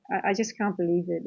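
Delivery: ordinary delivery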